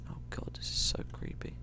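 scared whisper. Real, not acted. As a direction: breathe it out nervously.

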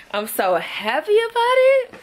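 squealing high with joy; bursting with happiness over a treat; giddy delight. Real, not acted. Vlogger thrilled by her self-care box, giddy high squeal.

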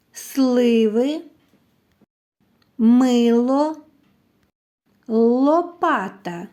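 each word drawn out slowly, syllable by syllable; slow sounding-out. Shown as a demonstration. Teacher helping children find a repeated sound, slow and drawn-out. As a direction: stretch every word so its sounds stand out.

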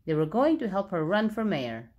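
ordinary delivery, nothing marked

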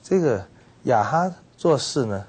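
ordinary talk, nothing marked

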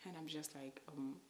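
ordinary talk

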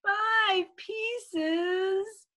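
put-on high, drawn-out child's whine; whining. Little P told to eat five pieces, whining. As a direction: whine the question in disbelief. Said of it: "he whined".

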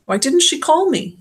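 high, upset question; hurt disbelief in the voice; dismayed. A narrator's reading. Doctor upset, comforting crying girl.

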